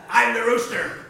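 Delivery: loud, commanding rooster announcement; big loud rooster voice; bossy rooster. Acted. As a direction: announce yourself forcefully as top bird. Rooster announces himself to the birds.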